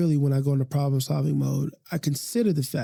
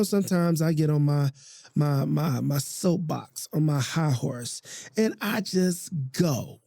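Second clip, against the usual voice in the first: usual speaking voice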